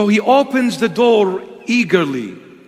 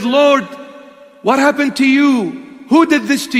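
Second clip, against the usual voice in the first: high, loud, stricken question; anguished plea; 6 semitones higher, 3 dB louder than usual. Retold or imitated. Prisoner asks the bleeding Lord who did this, anguished and loud. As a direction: cry out in shock at the wounds.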